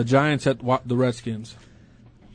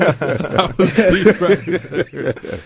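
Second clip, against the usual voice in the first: laughing; 4 semitones higher, 4 dB louder, much slower than usual. Real, not acted. Host cracks up at the joke.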